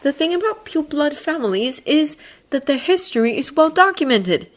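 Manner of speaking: put-on high, haughty boy voice; Draco voice. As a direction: play the superior schoolboy.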